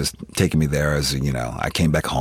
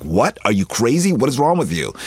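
shocked disbelief; 6 semitones higher than usual. Retold or imitated. Retelling his shocked reply to mom.

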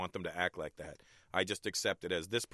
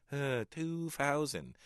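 put-on slow, higher Hong Kong accent; 5 semitones higher, much slower than usual. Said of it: "making a recording in my head".

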